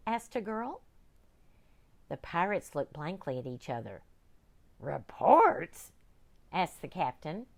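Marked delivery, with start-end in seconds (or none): 4.8-5.9 puzzled pirate. Acted. Puzzled captain.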